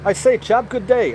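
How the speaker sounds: high-pitched mock English gent; badly faked, high English accent. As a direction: attempt an English accent and miss. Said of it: "I cannot do an English accent".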